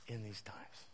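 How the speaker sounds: soft unvoiced trail-off; whispered. Real, not acted. Preacher fading into a whisper ending his point. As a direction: drop to a whisper.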